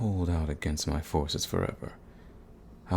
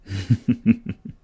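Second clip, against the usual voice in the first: amused villain chuckle; 5 semitones higher, 5 dB louder than usual. Acted.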